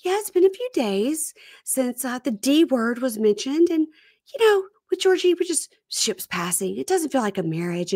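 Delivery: lightly mocking high wife's voice; high, mocking impression of a wife; high mock voice of Darcy. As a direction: squeak out her talking head, mocking. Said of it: "she goes".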